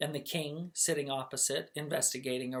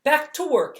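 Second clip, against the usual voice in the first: loud high call to order; commanding; 12 semitones higher, 12 dB louder than usual. Acted. Princess Hilda ordering the household, loud command. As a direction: snap everyone back to work.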